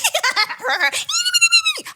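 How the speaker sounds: very high, loud mock giggle-shriek; giddy squeal. Shown as a demonstration. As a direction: manic gibbering squeal. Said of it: "I didn't go, like".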